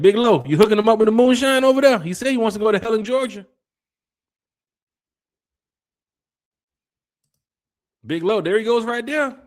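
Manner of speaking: high, loud hype for Big Lo; hyped call-out. Real, not acted. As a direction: shout him out with big energy. Hyping up Big Lo.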